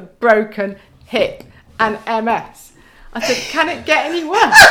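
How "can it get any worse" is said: high, loud laughing wail; hospital patient to husband, laughing exasperation; laughing despair. Retold or imitated. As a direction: throw up your hands, laughing. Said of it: "Like this".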